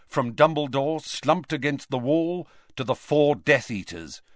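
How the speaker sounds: ordinary delivery, nothing marked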